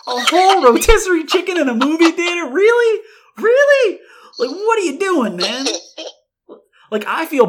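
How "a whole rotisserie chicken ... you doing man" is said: loud, high-pitched mock disbelief; incredulous outburst. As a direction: squawk it like you cannot believe it. Acts out disbelief at smuggled chicken.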